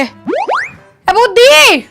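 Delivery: high, long-drawn holler; calling out. Acted. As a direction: stretch the name out in a high call. Calling someone at the house.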